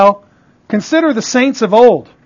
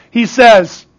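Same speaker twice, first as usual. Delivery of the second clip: ordinary delivery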